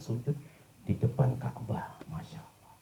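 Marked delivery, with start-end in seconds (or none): 2.1-2.7 hushed whisper of wonder; whispered awe. Real, not acted. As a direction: let it fall to a whisper. Awe after the death. (berbisik takjub)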